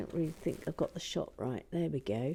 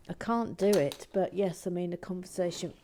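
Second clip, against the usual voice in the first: usual speaking voice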